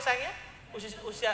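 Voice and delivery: ordinary talk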